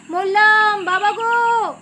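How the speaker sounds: high, loud wail of terror; dying cry. A narrator's reading. Bandit Bacchu cries out as the tiger leaps, high dying wail. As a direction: cry out as if being killed. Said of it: "শোনা গেল বাচ্চুর গলা".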